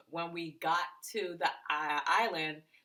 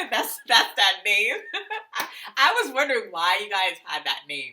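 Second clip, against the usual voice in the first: talking through giggles, louder; amused laughing; 4 semitones higher, 7 dB louder than usual. Real, not acted. Girlfriend teases about the block's name, laughing. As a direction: laugh at the name as you speak.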